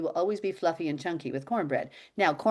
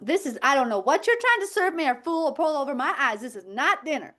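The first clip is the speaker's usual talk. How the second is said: mock bratty daughter protest; indignant kid; 6 semitones higher than usual. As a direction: play the outraged little girl. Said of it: "and she'll be like".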